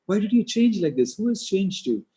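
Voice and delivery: high, loud bewildered questioning; growing surprise. Retold or imitated. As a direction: demand answers in astonishment. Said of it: "he was more and more surprised".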